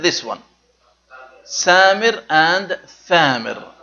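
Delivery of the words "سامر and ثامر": سامر with s and ثامر with th, spoken slowly; slow contrast of two words. Shown as a demonstration. Teacher slowly contrasts سامر and ثامر for students. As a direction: set سامر against ثامر clearly. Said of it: "I mean سامر".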